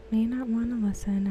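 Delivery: usual speaking voice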